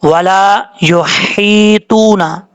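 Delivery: very slow युहीतूना with a clear throat ह; slow tajweed demonstration; correct recitation. Shown as a demonstration. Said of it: "कैसे पढ़ेंगे?".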